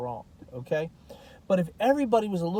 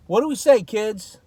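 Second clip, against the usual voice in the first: put-on calling out to the kids; 3 semitones higher, 7 dB louder than usual. Calling out to his audience.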